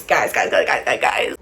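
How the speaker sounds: urgent whispered guys; whispering. Real, not acted. Whispered repeated calling.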